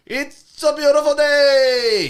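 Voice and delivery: high, loud, long-drawn cheery holler; imitating an ecstatic, drawn-out hello; overjoyed greeting. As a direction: sing it out as the happiest man around. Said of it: "He was in the best mood all the time".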